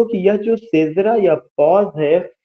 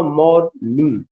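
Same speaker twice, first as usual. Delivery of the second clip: Morning split into मॉर and Ning; syllable by syllable; slower than usual. Shown as a demonstration. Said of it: "morning में दो syllable है". (Morning को तोड़कर, मॉर... निंग)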